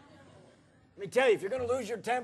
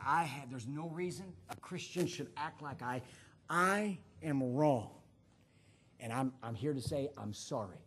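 humble confession; 8 semitones lower, 7 dB quieter than usual. Retold or imitated. Pastor admitting his wrong to onlookers, low and contrite. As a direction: own up quietly and sincerely. Continues his public apology.

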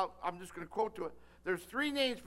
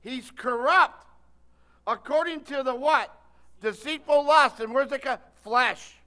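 fervent preaching; 2 semitones higher, 10 dB louder than usual. Acted. Preaching call and response.

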